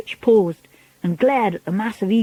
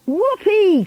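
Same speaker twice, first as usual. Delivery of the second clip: high, gleeful whoop; delighted cry; 11 semitones higher, 3 dB louder than usual. A narrator's reading. Witches cheering their leader's plan, high excited cry. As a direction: whoop like a thrilled crowd. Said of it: "cried the witches".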